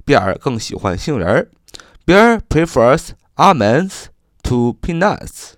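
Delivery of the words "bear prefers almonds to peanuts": slow example sentence. Shown as a demonstration. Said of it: "我们造个句子说".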